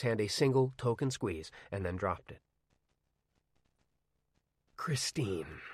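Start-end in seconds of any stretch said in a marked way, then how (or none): 4.8-5.6 breathy whispered name; dry whisper. Acted. Old man whispers the car's name to Arnie. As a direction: breathe the name out, barely voiced. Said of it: "he said, in a dry voice".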